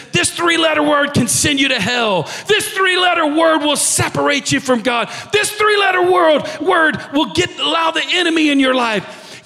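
preaching shout. Retold or imitated. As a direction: thunder it like a young country preacher. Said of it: "he goes on and he says".

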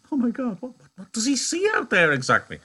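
spooked by the thought; creeped out. Real, not acted. Teacher spooked by Crooks' visions, pitch up. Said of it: "creeps me out".

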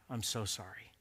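low, hushed sympathy; soft condolence. Retold or imitated. People console him after his dad died, softly sympathetic. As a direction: drop your voice to offer condolences.

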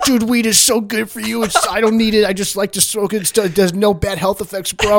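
high, excited mock stoner voice; hammed-up stoner insisting he's fine. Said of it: "He's like".

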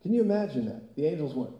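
ordinary delivery

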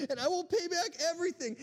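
a desperate servant's promise, lightly acted; begging. Servant begging master to wait, hammed-up plea. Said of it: "he begged".

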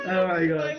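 high cracked-up laughter; dissolving into laughter; laughing. Real, not acted. Everyone cracking up.

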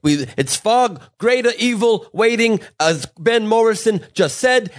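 slow, high-pitched stump-speech voice; Bernie Sanders. Acted. Comic imagining his concession speech for Hillary, politician impression. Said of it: "Bernie will say".

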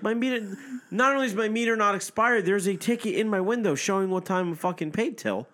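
high, emphatic retold protest; indignant protest. Driver retelling protest over an expired meter ticket, indignant. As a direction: re-enact righteous indignation, pitched well up.

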